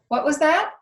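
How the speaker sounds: high, loud "what was that?"; a stern old librarian, loud and high; loud deaf librarian. A narrator's reading. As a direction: bark it at the girl over the counter. Deaf librarian asks loudly.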